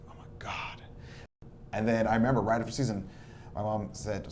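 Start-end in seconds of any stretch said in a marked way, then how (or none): 0.1-0.8 breathy whisper of dismay; whispered dread. Retold or imitated. Told he must finish the season, whispered dismay. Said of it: "And I was like".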